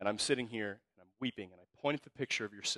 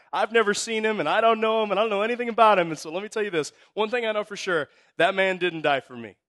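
much higher and louder, defiant; emphatic denial; 10 semitones higher, 9 dB louder than usual. Retold or imitated. Stranger Jimmy to Andy, loud and defiant. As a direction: push back hard on the claim.

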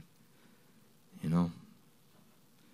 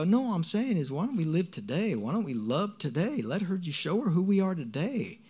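much higher-pitched, urgent insistence; impassioned plea, retold; 10 semitones higher than usual. Urging family to live and love today, impassioned. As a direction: plead the case, pitch swooping high.

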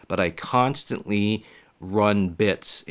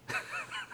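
laughing at own joke; 14 dB quieter than usual. Real, not acted.

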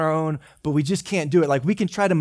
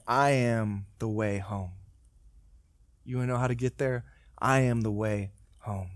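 tender Jesus; 5 semitones lower, 4 dB quieter than usual. Retold or imitated. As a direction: say it like a gentle invitation home.

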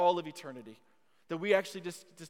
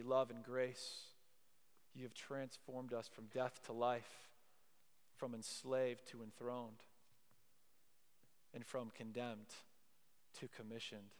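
quiet benediction, sinking softer toward the end; closing prayer; 5 semitones lower, 10 dB quieter than usual. Real, not acted. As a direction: pray softly and reverently, unhurried.

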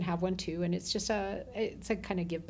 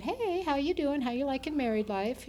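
forced cheerful greeting; 7 semitones higher than usual. Retold or imitated. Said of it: "And so I said".

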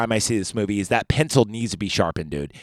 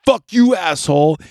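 higher and louder mock Terminator snarl; Terminator line, acted; 7 semitones higher, 6 dB louder than usual. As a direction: spit out "Fuck you, asshole." as an acted tough guy. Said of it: "And he goes".

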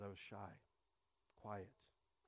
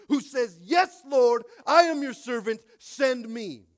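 loud, much higher climax over the music; preaching at full force; 6 semitones higher, 15 dB louder than usual. Acted. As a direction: build to a soaring cry of surrender. Sermon builds to a climax.

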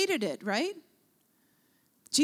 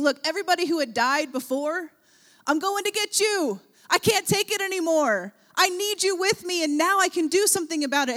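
higher, eager, urgent voice; rally the dead with urgency; urgent call; 6 semitones higher, 4 dB louder than usual. Retold or imitated. Preacher voicing Jesus calling those who had died. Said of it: "He is saying".

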